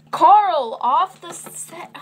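high, loud order off the set; shouting. Pretend. Host yells at Carl to leave the set, loud and high. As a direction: yell him away like a director.

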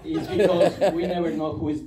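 usual speaking voice

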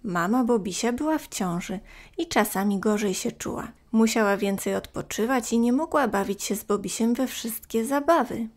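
ordinary delivery, nothing marked

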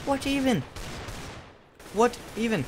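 high, loud bafflement; exasperated disbelief. Real, not acted. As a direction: exclaim it, utterly baffled. Baffled after being killed.

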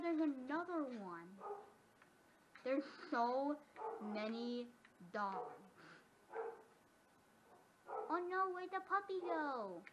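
ordinary delivery

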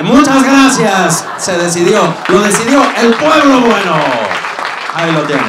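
booming mock declaration; triumphant proclamation. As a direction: proclaim the verdict to a crowd. Mock announcing of audience vote result.

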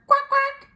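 high-pitched double quack; playful duck noise. Acted. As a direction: quack like a duck for small children. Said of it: "is the duck".

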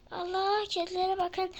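normal talking voice

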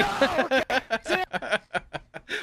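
high, helpless laughing burst; disbelieving laugh. Real, not acted. Player finding the hidden target, high laugh.

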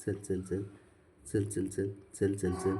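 low, hushed urging; soft coaxing. Real, not acted. Owner coaxing the sulking pet along, softly. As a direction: coax it along under your breath.

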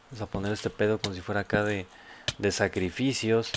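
ordinary delivery

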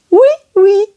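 oui oui sung high and cheerful like a cartoon name; Noddy's name. Shown as a demonstration. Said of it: "That is Noddy".

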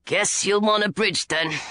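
a hint of a grumpy dwarf; gruff dwarf driver. A narrator's reading. Dwarf driver to the kids, a lightly gruff voice.

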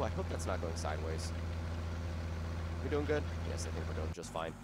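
normal talking voice

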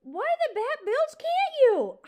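high, loud slow outburst; exasperated yell. Acted. Porcupine told the mouse won't leave, exasperated yell. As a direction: demand a reason at full volume.

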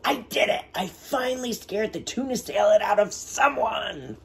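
high, excited cheer; thrilled little monster cheering. Acted. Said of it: "cheered Leonardo".

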